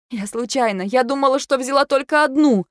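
high, anxious excuse; wife defending herself, voice lifting in fear; frightened excuse. A narrator's reading. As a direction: plead it was an accident.

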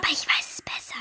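put-on child's conspiratorial whisper; secretive whisper. (Mädchen flüstert Lise ein Geheimnis zu)

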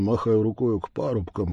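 ordinary delivery

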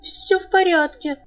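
high, slow, trembling reassurance nobody would believe; shaky unconvincing. Acted. Girl unconvincingly reassuring her worried friend, trembling. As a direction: try to sound fine and fail. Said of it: "дрожащим, исключительно неубедительным голосом".

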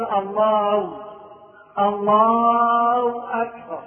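long slow الله ending in a w, no h; ha replaced by waw. Shown as a demonstration. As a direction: say Allaaw akbar, stretching الله. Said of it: "يحذف الهاء خالص ويضع مكانها واو".

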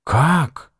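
high-pitched gasp of a question; taken aback, pitch leaping up; astonished exclamation. A narrator's reading. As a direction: exclaim in amazement. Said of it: "изумлённо воскликнул Ихтиандр".

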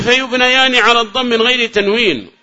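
reading out the text. Real, not acted. Teacher reads the author's rule to students, declaimed.

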